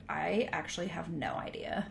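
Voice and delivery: hushed, uncertain aside; unsure whisper. Retold or imitated. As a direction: whisper it, not sure. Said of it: "And I was like".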